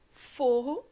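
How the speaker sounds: Forro stressed on the first syllable, said loud. Shown as a demonstration. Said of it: "Viram como são palavras completamente diferentes".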